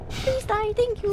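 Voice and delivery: high, chirpy politeness; high mock-polite voice. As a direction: chirp it sweetly at the enemy. Same words said again higher.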